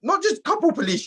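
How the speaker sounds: shocked and indignant; incredulous. Real, not acted. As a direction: voice jumps high in disbelief. Outraged disbelief retelling.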